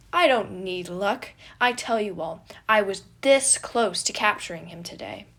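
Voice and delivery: boastful brag. Acted. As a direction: proclaim it proudly and loud. Boastful knight bragging.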